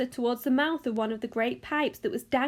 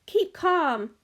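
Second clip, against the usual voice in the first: raised, higher call; cried out; 5 semitones higher, 5 dB louder than usual. A narrator's reading. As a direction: cry out to settle her panic. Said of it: "cried Mister Wonka".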